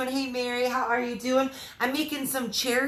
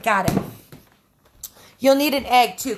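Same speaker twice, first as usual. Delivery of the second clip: ordinary talk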